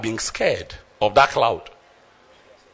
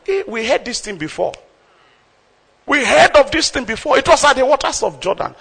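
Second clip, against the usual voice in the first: excited disciples; 9 semitones higher, faster than usual. Acted. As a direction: blurt it out like you just remembered. Disciples voiced recognizing voice.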